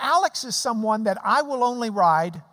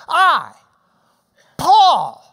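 "I" and "Paul" louder and higher; emphatic I; 6 semitones higher, 10 dB louder than usual. Shown as a demonstration. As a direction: stamp "I" and "Paul" with weight. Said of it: "it's Paul writing the letter".

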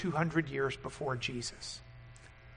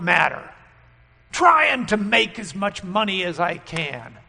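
loud, impassioned sermon; preaching at full force; 4 semitones higher, 7 dB louder than usual. Acted. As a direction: preach it hard to the congregation.

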